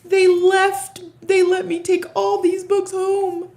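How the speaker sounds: high, giddy and thrilled; squealing with delight; excited. Real, not acted. Excited about library haul.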